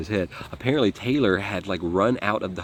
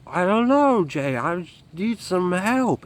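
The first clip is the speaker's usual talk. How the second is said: higher, slow, woozy pleading; dazed drugged plea; 11 semitones higher, 4 dB louder, slower than usual. Retold or imitated. Friend on speakerphone begging Jay for help, dazed slow higher plea. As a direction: beg for help like you're barely holding on. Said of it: "You can hear it in his voice".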